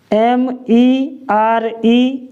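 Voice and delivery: letters spelled slowly one by one; patient letter-by-letter spelling. Shown as a demonstration. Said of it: "इसको हम क्या पढ़ेंगे".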